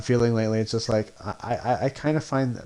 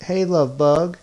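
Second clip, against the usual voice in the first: higher-pitched, lightly imitated condescension; mock condescending; 6 semitones higher, 4 dB louder, slower than usual. Imitating a creator greeting someone insincerely, condescending. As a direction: talk down with fake sweetness. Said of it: "and condescending".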